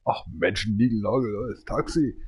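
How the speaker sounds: usual speaking voice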